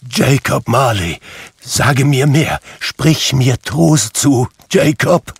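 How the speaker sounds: high, loud begging; pleading. A narrator's reading. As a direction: implore a dead friend for comfort. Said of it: "sagte er flehend".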